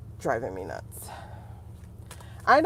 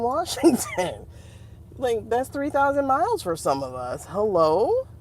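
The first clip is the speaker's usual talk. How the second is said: normal talking voice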